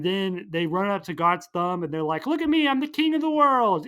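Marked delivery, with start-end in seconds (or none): 2.3-3.9 much higher and louder, king of the world; triumphant cry. Retold or imitated. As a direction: crow it from the mountaintop. Boys triumphant on the mountain.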